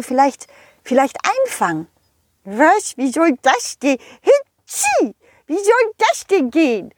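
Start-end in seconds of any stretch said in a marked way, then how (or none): 4.2-6.9 high, fast complaining snowman voice; the snowman pleads it tickles so much; whiny snowman. Acted. Snowman sneezes and asks. (hohe, quengelnde Schneemannstimme)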